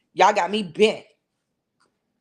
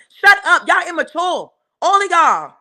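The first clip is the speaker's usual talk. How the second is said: shouting; 7 semitones higher, 5 dB louder than usual. Real, not acted. Ranting at a rival, yelling.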